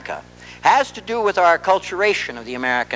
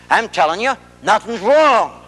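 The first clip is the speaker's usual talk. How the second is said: irritated insistence; 7 semitones higher, 4 dB louder than usual. Acted.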